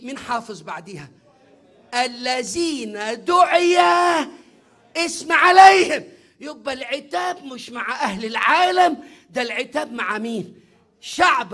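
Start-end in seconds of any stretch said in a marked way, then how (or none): 1.9-6.0 slow, much louder, rising proclamation; proclaiming shout. Real, not acted. Preacher shouting the verse to the congregation. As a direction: thunder the verse out slowly.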